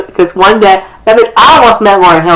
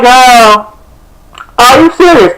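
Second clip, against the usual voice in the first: incredulous; 6 semitones higher, slower than usual. Retold or imitated. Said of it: "I'm like".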